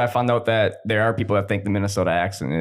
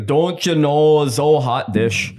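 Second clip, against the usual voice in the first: slow, drawn-out, higher Minnesotan vowels; thick Minnesotan accent; 4 semitones higher, 4 dB louder, much slower than usual. Shown as a demonstration. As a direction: stretch it out like an old Minnesotan. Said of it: "That's when you get the".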